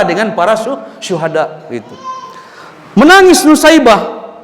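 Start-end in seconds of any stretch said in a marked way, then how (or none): 3.0-4.3 emotional climax. Acted. Preacher climax on weeping.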